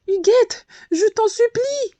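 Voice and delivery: high, loud begging call; pleading cry. A narrator's reading. The abandoned bee calling after her cousin, a high pleading cry. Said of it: "lui cria la petite reine".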